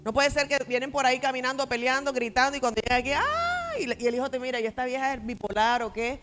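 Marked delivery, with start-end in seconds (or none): 3.1-3.8 high, gushing church "ay"; mock sweet greeting. Parent switching to sweet greeting at church, mock gushing. 4.6-6.2 put-on indignant child. Child's indignant thought about mother.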